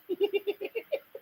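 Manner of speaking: soft, high giggle; quick high chuckles trailing off; teasing laugh. Real, not acted. Laughs after mocking question.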